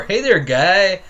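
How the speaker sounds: sneering imitation of a fake friendly greeting; mock fake greeting. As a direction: stretch the guy mockingly. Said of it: "sounds so fake to me".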